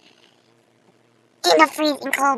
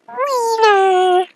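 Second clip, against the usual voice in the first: squeaky high; 10 semitones higher, 10 dB louder than usual. Pretend. Silly high emphasis.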